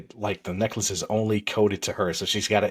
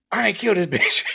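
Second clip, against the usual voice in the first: laughing order; 11 semitones higher, 4 dB louder than usual. Retold or imitated. Character ordering in retold story.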